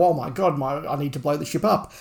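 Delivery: normal talking voice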